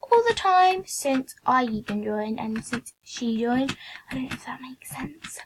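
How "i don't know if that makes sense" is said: whispered self-conscious aside; whispering. Real, not acted. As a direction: whisper it, unsure of yourself.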